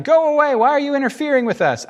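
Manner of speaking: high, loud cry of protest; demon's shout. Acted. Evil spirit cries out at Jesus in the synagogue, shouting. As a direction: cry out like a spirit under threat. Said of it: "cried out, shouting".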